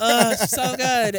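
high, loud, slow gushing swoon; swooning sigh. Real, not acted. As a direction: melt over her, drawing the words out. Swooning over the singer.

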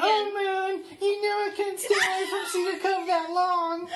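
thin, high pinched repeat. Shown as a demonstration. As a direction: squeeze it thin and high again. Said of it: "Do it again, do it again".

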